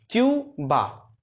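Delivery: Cuba drawn out, very slow syllables; stretched out slowly. Shown as a demonstration. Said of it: "drilling the word". (Cuba को धीरे-धीरे खींचकर)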